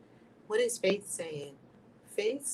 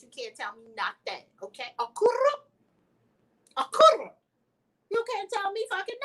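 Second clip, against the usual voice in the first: high, sassy rapper brag; 12 semitones higher, 5 dB louder than usual. Retold or imitated. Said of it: "in my [name] voice".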